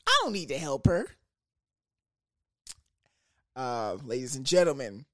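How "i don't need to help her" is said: high mocking voice. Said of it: "she's like".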